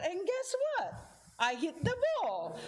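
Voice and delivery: high, thrilled build-up; excited. Real, not acted. Telling the group about hitting the ball, high and thrilled. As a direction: burst out with the triumph.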